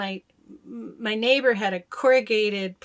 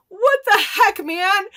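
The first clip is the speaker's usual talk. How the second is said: high, loud exclamation; incredulous outburst; 12 semitones higher, 10 dB louder, slower than usual. Real, not acted. Telling hosts the aliens were real, loud disbelief. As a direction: blurt it out in disbelief.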